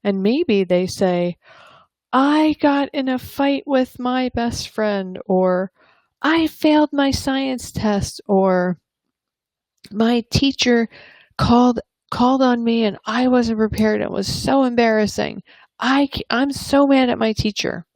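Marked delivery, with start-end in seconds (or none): 2.1-5.2 put-on upset child. Child explains bad day. 6.2-8.2 put-on upset child. Child explains bad day. 9.9-17.9 put-on upset child. Child vents about teacher.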